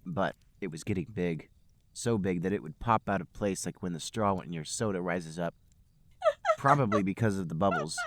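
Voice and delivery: ordinary talk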